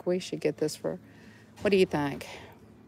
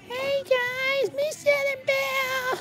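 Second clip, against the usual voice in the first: squeaky toy voice; slower than usual. Pretend. Voicing a toy greeting.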